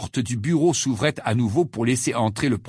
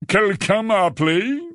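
high-pitched feigned surprise; stagey, raised-pitch question; theatrical start; 8 semitones higher, 3 dB louder than usual. A narrator's reading. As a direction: play at being woken with a start. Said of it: "Dans un sursaut théâtral".

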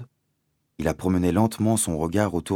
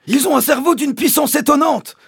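very high, loud character voice; emphatic doctor; 9 dB louder than usual. Acted. Doctor confirming the father's claim, high and emphatic.

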